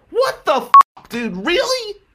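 loud, high, exasperated "What the f-"; outraged outburst. Real, not acted. Venting to viewers about the classmate, loud and exasperated. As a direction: explode in disbelief.

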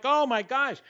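high, quick, animated burst; imitating your own hyped-up self; high-energy excitement. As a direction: bubble over asking about dinner. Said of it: "in a very high energetic mode".

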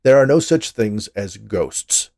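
loud, slow insistent outburst. A narrator's reading. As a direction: burst out loudly, stressing every word. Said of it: "Dolores ignored his outburst".

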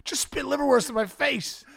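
high-pitched comic protest; mock outrage. Complains after being hit.

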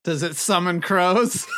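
ordinary delivery

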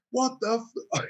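high mock shock after being soaked. Barman imitating the soaked customer, high mock shock. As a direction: splutter in disbelief.

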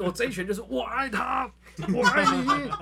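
high, loud, laughing battle cry; hammed-up manga hero shout. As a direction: yell it like a comic fighter. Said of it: "然后说就是".

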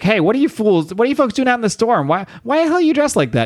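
high, fast surprised cop voice, pitched well up; a cop yelling at weirdos in the storm; startled cop. Acted. Cop shouting at strangers in the storm, high and startled.

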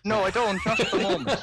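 high, loud cartoon leprechaun. Irish guest answering the leprechaun question, mock leprechaun voice. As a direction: put on a squeaky leprechaun voice, laughter creeping in. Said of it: "sound like a leprechaun".

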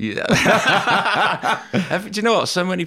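bursting into laughter, high and loud; laughing. Real, not acted. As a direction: laugh through the words. Laughing at being called twins.

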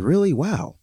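high-pitched, incredulous really; shocked at the insult, voice jumping up high; stunned disbelief. Retold or imitated. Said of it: "I'm like".

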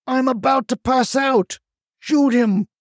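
high, choked-sounding desperation; strained panic. A narrator's reading. The choked narrator begging Charlie to shoot, strained panic. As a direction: squeeze it out high, as if being strangled.

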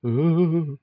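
high, hollow ghostly moan. Shown as a demonstration. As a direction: groan like a spirit in the attic. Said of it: "There you go".

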